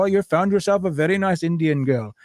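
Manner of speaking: Indian-accented parent impression. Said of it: "because I did the voice".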